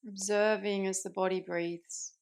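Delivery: calm meditation guide with long pauses between phrases; unhurried, settled voice, easing listeners into rest; guided meditation. Acted. Yoga teacher guiding listeners into Yoga Nidra, calm guided meditation.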